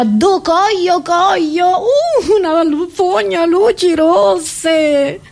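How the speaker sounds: high, drawn-out mock-ecstatic wail; hammed-up revelling. Comedian voices red-light sewer revels, hammed-up ecstasy. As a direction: play it wildly overexcited, stretching the vowels. Acting out bacteria's orgy.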